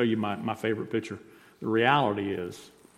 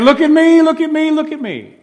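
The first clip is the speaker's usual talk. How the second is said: high, full-voiced attention-seeking yell; crying out loudly; 15 dB louder than usual. Show-off in the town square, crying out for attention. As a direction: cry out at the top of your voice. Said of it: "crying out at the top of his voice".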